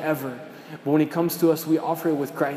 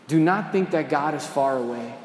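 ordinary talk

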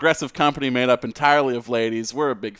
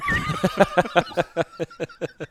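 bursting out in a high laugh; 8 semitones higher than usual. Real, not acted. Laughing at the joke.

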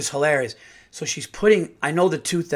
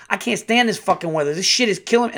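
high, louder cursing imitation; mock gangster rant, high and louder; gangster hygienist; 6 semitones higher, 4 dB louder, faster than usual. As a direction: go suddenly gangster while griping. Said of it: "she just went gangster on me".

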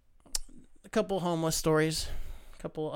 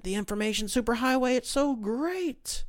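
imitated adoring rant; 7 semitones higher than usual. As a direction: imitate him raving with delight. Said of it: "how he loves it".

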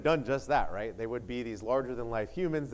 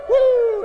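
very high, very loud "woo"; shouted whoop; 16 dB louder than usual. Pretend. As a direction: let out a full-blast cheer. Cheer after the retried announcement.

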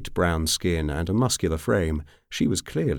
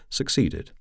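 ordinary delivery, nothing marked

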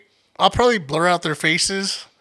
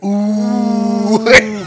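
drawn-out, louder crowd "ooh"; stirring crowd; 3 semitones higher, 5 dB louder than usual. Retold or imitated. Whole living room reacting to the challenge, instigating crowd. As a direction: egg them on like onlookers. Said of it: "everybody was like".

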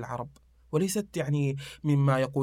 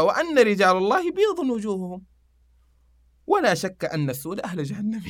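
loud, raised declaiming of a couplet; verse recitation; 5 semitones higher, 3 dB louder than usual. Acted.

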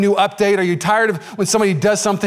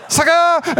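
mocking taunt; 11 semitones higher than usual. Taunting beaten friend.

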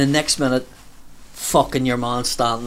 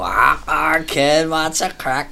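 put-on slow, raised drunken greeting; drunk man in his fifties; 4 semitones higher, much slower than usual. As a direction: drag it out like a merry old drinker. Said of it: "he fucking spoke like that there".

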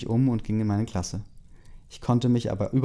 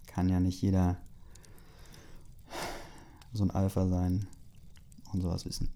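snide whispered aside; 3 semitones lower, 7 dB quieter than usual. Real, not acted. Snide aside about the alpha.

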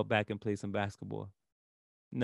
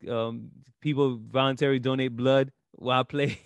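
ordinary talk

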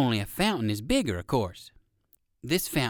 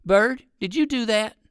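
louder, higher call up to a bird; 8 semitones higher, 6 dB louder than usual. Acted. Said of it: "calling to the bird".

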